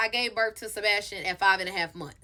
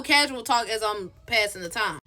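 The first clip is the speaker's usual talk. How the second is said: ordinary talk, nothing marked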